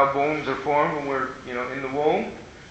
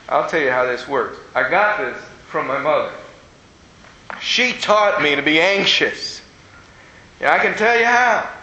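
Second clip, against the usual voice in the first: usual speaking voice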